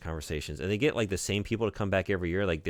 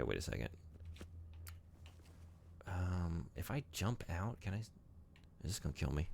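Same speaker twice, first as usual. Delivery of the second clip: ordinary delivery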